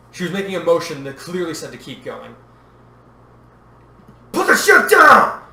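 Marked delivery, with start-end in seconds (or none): 4.3-5.4 full-force high, drawn-out yell; desperate bellowed order; roaring command. A narrator's reading. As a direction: roar the order slowly, at full volume. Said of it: "Anakin roared".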